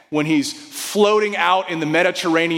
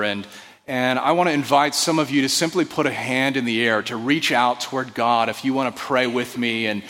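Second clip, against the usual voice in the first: gentle, calm invitation to pray; prayer invitation; 5 semitones lower than usual. Real, not acted. Leads into prayer.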